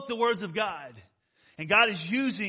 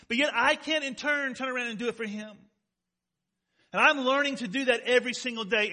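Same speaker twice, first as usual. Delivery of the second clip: impassioned, raised pulpit voice; passionate preaching; 4 semitones higher, 4 dB louder than usual. Real, not acted. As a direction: preach it like it is personal.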